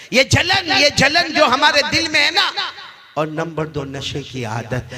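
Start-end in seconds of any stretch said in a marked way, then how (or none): 0.1-2.5 high, loud warning to the congregation; emphatic sermon outcry; full-force preaching. Real, not acted. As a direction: hammer the point home, pitch up.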